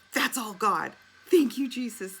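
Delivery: loud, high praising shout; joyful praise. Retold or imitated. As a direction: shout thanks to Jesus. Friend praising God loudly.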